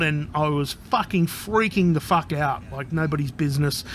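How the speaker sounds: ordinary talk, nothing marked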